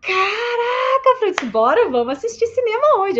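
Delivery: high, loud excited cry of delight; mock thrilled patient voice. Excited patient greets cinema session.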